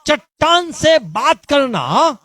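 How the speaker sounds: high, loud sermon cry; a preacher rousing the congregation; fiery preaching. Acted. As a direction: preach it out at full force. Preaching at full force.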